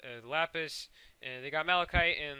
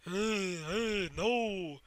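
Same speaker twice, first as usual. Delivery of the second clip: cluster creature voice; 6 semitones higher, much slower than usual. Retold or imitated.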